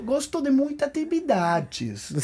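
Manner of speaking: high, slow Brazilian sing-song; playful Brazilian Portuguese accent. Pretend. Answering the co-host's list of his classes, mock-Brazilian lilt.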